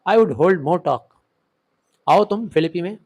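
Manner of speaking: usual speaking voice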